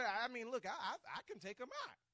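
high, loud eager boast as David; bold insistence. Acted. David volunteering to fight Goliath, bold insistence. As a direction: insist you can win this fight.

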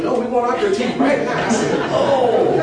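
high and loud, laughing through it; can barely get it out for laughing; laughing retelling. Real, not acted. Preacher laughing as he retells the class demanding critique.

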